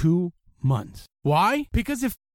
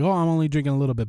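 drinker's excuse; 2 semitones lower, much faster than usual. Retold or imitated. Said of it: "they're like".

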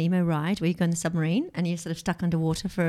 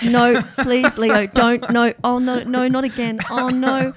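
louder, higher desperate pleading; pleading panic; 5 semitones higher, 5 dB louder, slower than usual. Retold or imitated. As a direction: beg in rising panic. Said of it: "And I was like".

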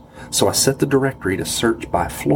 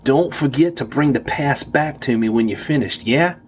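put-on New York guard accent, higher, rising yeahs; 5 semitones higher than usual. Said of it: "I could recognize his New York accent now".

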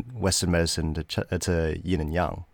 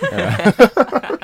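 high squeal of laughter; laughing; 8 dB louder than usual. Real, not acted. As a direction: laugh through a mouthful. Laughing with mouth full.

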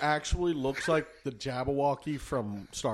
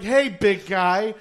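loud, high, drawn-out mock greeting; exaggerated greeting; 6 semitones higher, 6 dB louder, much slower than usual. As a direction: stretch out an overfriendly hello. Re-enacting the friend's message.

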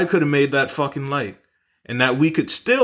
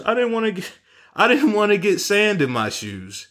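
put-on high, whiny little girl voice; girl's voice; 9 semitones higher than usual. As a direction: play the eleven-year-old, much higher and put out. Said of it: "she goes".

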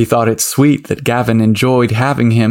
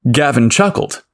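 ordinary delivery, nothing marked

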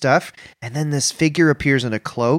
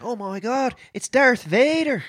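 high, slow East Coast voice; a Maritimer spotting Darth Vader; East Coast accent; 10 semitones higher, slower than usual. Retold or imitated.

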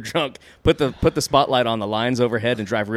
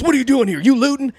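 mock cop yell; 13 semitones higher, 6 dB louder than usual. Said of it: "And he goes".

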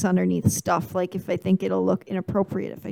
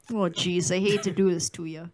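apologetic, softer Wisconsin tough guy; Wisconsin accent; 3 semitones lower, 5 dB quieter than usual. Acted. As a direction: regretfully threaten in a Wisconsin accent. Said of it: "if he was in Wisconsin".

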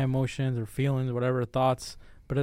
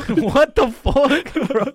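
very high, slow, loud laughing cry; laughing at a friend's joke, in disbelief; 12 semitones higher, 9 dB louder, much slower than usual. Real, not acted. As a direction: squeal it through laughter.

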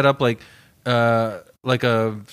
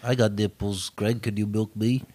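De Niro impression; 7 semitones lower, 7 dB quieter than usual. Quotes De Niro's film line.

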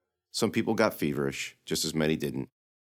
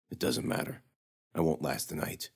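soft, near-whispered resignation; resigned hush; 5 dB quieter than usual. Acted. Dying knight telling the girl he won't last, hushed. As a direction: give up the words, barely voiced.

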